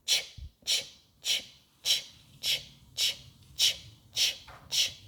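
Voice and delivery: steady soft "ch" puffs without voice; train chugging noise. Shown as a demonstration. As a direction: imitate a train setting off with "ch". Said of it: "Make the sound".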